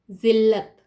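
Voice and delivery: जिल्लत as "zillat", firm and loud; z sound. Shown as a demonstration. Teacher reads the Urdu word, जिल्लत with z. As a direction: lean on the opening z of जिल्लत. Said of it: "इसको जिल्लत पढ़ेंगे".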